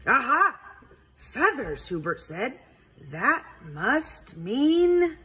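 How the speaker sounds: high, eager sleuth voice; a bright, triumphant detective; owl detective voice. Acted.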